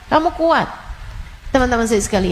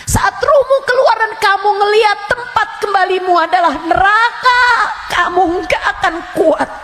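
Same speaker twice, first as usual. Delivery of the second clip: loud, high warning sermon; fiery preaching; 11 semitones higher, 4 dB louder than usual. Acted. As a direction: thunder a warning about the grave. Preaching at full force about death. (ceramah berapi-api dengan suara tinggi dan keras)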